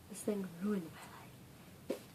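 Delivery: slow unvoiced whisper on ruined; whispered drama. Real, not acted. Fan mourning a book, slow whisper.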